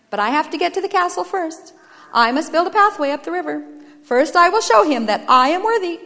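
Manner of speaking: mildly strained earnestness; striving brother. Acted. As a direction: press your case to earn approval. Toiling brother insists on working.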